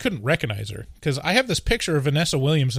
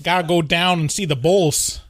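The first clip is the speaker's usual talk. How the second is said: hammed-up Chicago accent; 3 semitones higher than usual. Shown as a demonstration. Showing a co-host the local speech, Chicago accent. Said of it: "I'm saying that's a Chicago accent".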